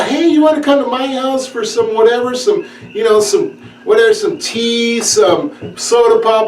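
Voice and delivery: breezy high dating guy voice; chirpy invite, lightly put on; guy asking his date over. Young man inviting his date home, in a breezy voice.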